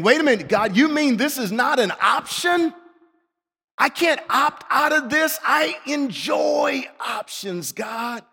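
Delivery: high, pleading disbelief; incredulous protest. Acted. Preacher asking God for a way out, incredulous. Acted objection to God's command.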